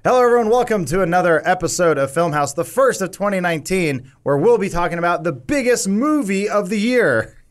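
podcast host intro. Acted. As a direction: announce it like a show host. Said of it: "I'll do a real intro".

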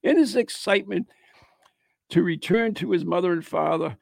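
measured read-aloud narration; ghost story read aloud. Acted. Host reading a Christmas Eve ghost tale, narrating. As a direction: read it out as a local legend.

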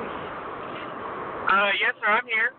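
muffled, windy phone line; in a wind tunnel. Real, not acted. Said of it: "It sounds like you're in a wind tunnel".

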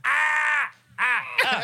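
short, high, loud shrieks; mock scream. Co-host reacting to the revealed name, mock scream. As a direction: shriek in pretend shock.

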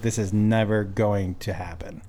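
low, soft, slow thought; quiet inner voice. Retold or imitated. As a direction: mutter it to yourself, slowly. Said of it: "I was like".

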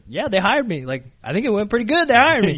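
pleased, animated announcement; excited news. Retold or imitated. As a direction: share good news with mom, voice lifted. Calling mom with job news.

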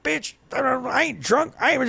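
slow, slurred mock drunk; drunk uncle. Drunk uncle denying he's drunk, mock slur. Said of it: "My drunk uncle, he's like".

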